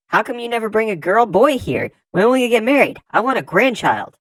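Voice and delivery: high, loud demanding parent voice. Acted. Parents pressing their child for marriage and grandchildren, high loud nagging.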